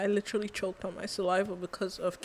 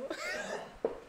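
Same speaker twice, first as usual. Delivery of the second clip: squealing high-pitched laugh; 4 dB quieter than usual. Real, not acted. Reacting to the video, squealing high laugh.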